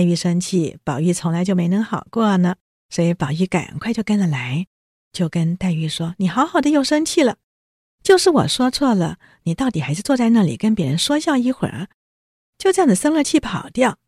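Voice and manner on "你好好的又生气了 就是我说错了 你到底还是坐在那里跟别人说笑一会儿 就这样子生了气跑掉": 着急劝说. Retold or imitated. 宝玉着急劝黛玉.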